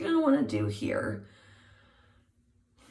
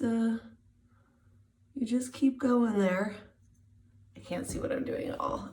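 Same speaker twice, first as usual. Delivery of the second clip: ordinary talk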